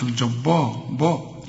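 الجبار broken off on a dark BAA; wrong heavy ba. Shown as a demonstration. Teacher repeats the mistake, heavy الجبار. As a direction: mispronounce الجبار with a thick, backed با. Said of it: "ما تقولش".

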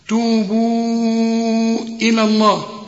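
توبوا إلى الله slow, madd held four counts; model recitation. Shown as a demonstration. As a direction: stretch توبوا. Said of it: "توبوا تمد أربع حركات". (توبوا بمد أربع حركات)

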